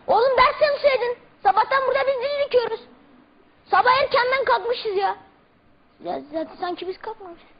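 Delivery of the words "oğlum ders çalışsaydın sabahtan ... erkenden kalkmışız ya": high, fast scolding teacher. Retold or imitated. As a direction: scold the lazy student. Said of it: "Türkçe öğretmenimin taklidini yapacağım".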